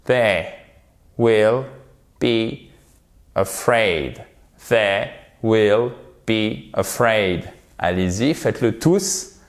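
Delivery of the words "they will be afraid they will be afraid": "They will be afraid" slowly, word by word; detached words. Shown as a demonstration. Teacher modelling for learners to repeat, slow detached words. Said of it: "Vous détachez bien les mots".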